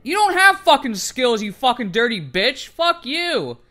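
real furious comeback; raging. Real, not acted. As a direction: lash back at the taunting opponent. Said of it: "I was raging a little bit there".

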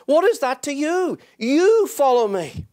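pleading rise, hitting you; preaching climax. Acted. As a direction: preach it at full force.

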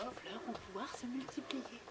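hushed secret whisper. Acted. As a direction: breathe it, no voice. Secret told to child.

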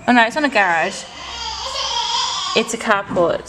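ordinary talk

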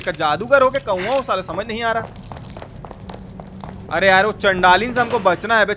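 ordinary talk

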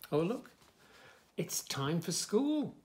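ordinary delivery, nothing marked

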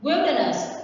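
"Wilderness" stressed on WIL-; WILderness, as a model word; stress on first syllable. Shown as a demonstration. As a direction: hit the first syllable of "Wilderness", then fall. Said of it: "the stress is on the first one".

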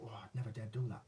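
breathy hushed whisper to himself; a half-joking whispered aside; whispered thought. Retold or imitated. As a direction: whisper it like a private doubt. Imagined inner thought, hushed.

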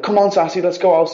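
high-pitched call to a dog; coaxing a pet. Retold or imitated. Owner calling her dog to go out, coaxing.

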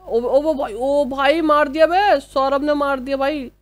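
high, loud hyped yell; excited shout. Real, not acted. Gamer cheering his squadmate's kill, excited shout. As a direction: scream it out like the kill just happened.

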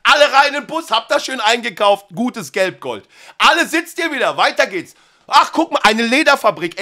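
chirpy fake enthusiasm for the next shop; cheery tour guide. Guide herding tourists.

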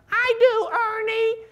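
put-on old lady voice. Old teacher answers from back row.

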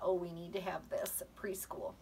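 soft whisper of a remembered thought; whispered recalled thought. Retold or imitated. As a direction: whisper it like a secret to yourself. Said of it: "I thought".

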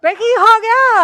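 loud, slow alarmed call from the next room. Acted. Mother calls anxiously to her son, loud and drawn out. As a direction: call out to your son, worried something happened.

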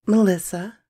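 high, small voice with rising end; timid young girl. Acted. Bought girl telling the rider her name, timid. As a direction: answer shyly in a girl's high voice.

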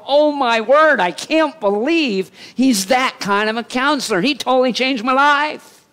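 high, loud amazement; awestruck. Retold or imitated. A believer amazed by the wonderful counselor, high and loud. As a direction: gush like your life was just changed. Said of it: "It fills you with this feeling of".

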